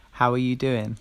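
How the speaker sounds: ordinary talk, nothing marked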